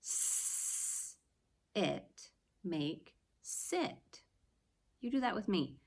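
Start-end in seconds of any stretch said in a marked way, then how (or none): 0.0-2.3 put-on teacher segments sit for kids; sounding out. Shown as a demonstration. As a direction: hiss s, then say it. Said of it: "Do you hear that in sit?".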